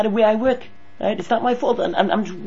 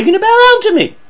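indignant rebuke; 7 dB louder, faster than usual. Retold or imitated. Quoting acted father rebuke.

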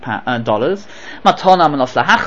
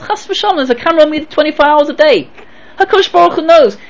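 high, earnest pious voice; 12 semitones higher than usual. Retold or imitated. Pious electrician explains.

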